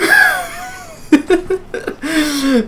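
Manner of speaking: high, teary cackle of laughter; shrieking laugh. Real, not acted. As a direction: laugh until it almost turns to crying. Laughing at co-host joke.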